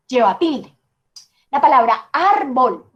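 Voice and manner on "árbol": "árbol" with a long, punched AR; stress on first syllable. Shown as a demonstration. Teacher introduces the example word, "árbol" stressed long on AR. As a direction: stretch the AR of "árbol", let bol fall. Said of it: "no, digo árbol".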